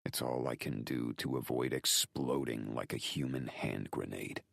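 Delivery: ordinary delivery, nothing marked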